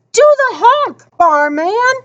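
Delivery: high spectator's holler; crowd cheer. A narrator's reading. Crowd members calling out cheers.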